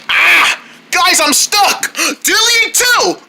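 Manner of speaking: high, slow cry for help; panicked call for help. Pretend. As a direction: shout to friends while stuck. Character stuck, calling for help.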